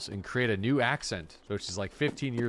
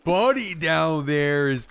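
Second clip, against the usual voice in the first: slow, loud, higher mock Canadian "buddy"; prairie Canadian accent; 6 semitones higher, 7 dB louder, slower than usual. Imitating a prairie local talking about his buddy, in a slow, loud Canadian accent. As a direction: stretch it out in a hammed-up Canadian prairie voice. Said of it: "you're gonna get a lot of that".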